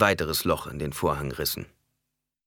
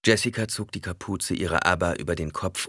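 usual speaking voice

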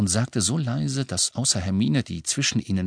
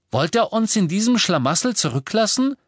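much higher, outraged question; indignant challenge; 12 semitones higher, 4 dB louder than usual. A narrator's reading. Michael Corner challenges Harry's leaving, indignant. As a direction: protest at being left behind.

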